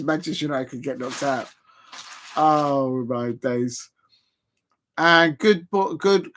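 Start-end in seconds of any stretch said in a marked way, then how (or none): 2.4-3.9 drawn-out oh my days. Real, not acted. Watching a tense penalty risk.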